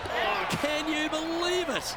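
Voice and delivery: high, slow amazed exclamation; stunned disbelief. Real, not acted. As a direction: marvel at the impossible shot. Commentator sees hole in one.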